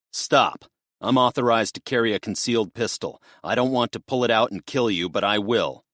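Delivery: loud, fast military command. Acted. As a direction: warn him like a Marine officer. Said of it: "Then he spoke in his military voice".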